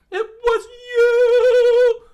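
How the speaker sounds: very loud falsetto shriek, hugely drawn out; furious scream. Acted. Cook exposes the food thief, furious scream. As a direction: scream the accusation at full force, high and long.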